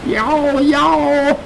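high, repeated yowls; cat's yowl. Acted. Cat directing the kid to the man, yowling. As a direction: yowl twice, urgently.

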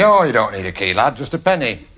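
lightly voiced gruff club chairman; a hint of a deadpan northern chairman delivering a punchline; mock chairman voice, amused; concert chairman. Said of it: "And he'd say".

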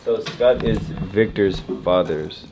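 slow, low and soft; unhurried repeat of the riddle, voice dropped; puzzling it out. Real, not acted. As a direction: think aloud, word by word. Player slowly puzzles over riddle.

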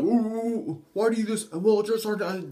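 mock a recruit who rambles; whiny long answer. Recruit giving the drill sergeant a long excuse, whiny. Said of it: "Don't give them a long ass".

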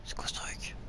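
hushed stunned whisper; awed whisper. Real, not acted. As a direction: breathe it out in awe. Boss appears, awed reaction.

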